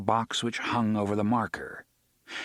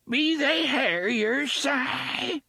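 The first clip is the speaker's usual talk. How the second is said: put-on high, slow, cracked old woman's voice; cracked quavering old woman; much slower than usual. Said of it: "in a cracked, quavering voice".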